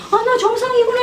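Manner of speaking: high relieved realization; a child realizing he is normal. Retold or imitated. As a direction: voice the kid's sudden relief. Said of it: "이렇게 뉘앙스를 줘야 돼".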